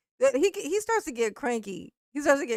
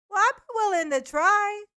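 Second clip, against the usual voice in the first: higher-pitched imagined reply; mock willing reply; 9 semitones higher, 3 dB louder than usual. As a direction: play the eager would-be cook, pitched up. Acting out an imagined argument.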